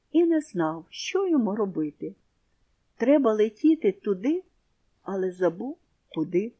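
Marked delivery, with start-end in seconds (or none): none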